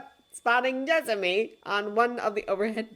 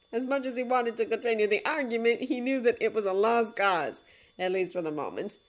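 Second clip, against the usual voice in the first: ordinary delivery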